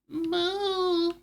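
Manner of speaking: high, long moo; drawn-out high cartoon moo; cow moo. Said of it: "I found my moo".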